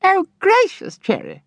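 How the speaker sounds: very high, giggly exclamation; cried out. A narrator's reading. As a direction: squeal at her sister in delight. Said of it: "cried Miss Mercy".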